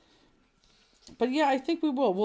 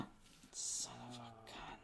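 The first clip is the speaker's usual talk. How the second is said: breathy gasp, then whisper; 9 semitones lower, 22 dB quieter, much slower than usual. Real, not acted. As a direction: whisper it in surprise. Gasps at something onscreen.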